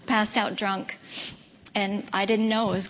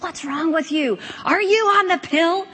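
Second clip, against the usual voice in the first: mom confronting; 7 semitones higher, 4 dB louder than usual. Retold or imitated. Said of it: "she squares her shoulders with me".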